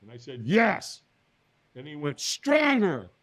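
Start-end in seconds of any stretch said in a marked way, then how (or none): 0.5-1.0 higher, punched-out affirmation; obedient yes. Retold or imitated. Firewalker answers the trainer, forceful yes. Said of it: "Squeeze your fist and say yes". 2.2-3.1 high, forceful coach's call; urging command. Retold or imitated. Trainer pushes firewalker for more, urging command.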